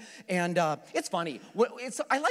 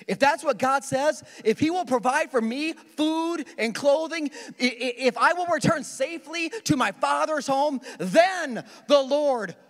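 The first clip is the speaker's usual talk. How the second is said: higher, louder, slow pleading bargain; bargaining Jacob; 6 semitones higher, 4 dB louder than usual. Acted. Jacob bargaining with God for safe return, pleading higher. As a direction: plead each condition with God. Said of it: "He says".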